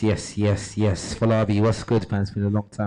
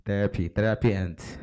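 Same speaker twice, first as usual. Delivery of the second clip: ordinary talk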